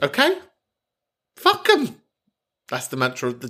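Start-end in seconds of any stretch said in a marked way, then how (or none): 0.0-2.0 very high-pitched squeak; high, playful squeal of defiance; comic defiance. Acted. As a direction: squeak out a cheeky rallying cry. Squealed rallying mantra.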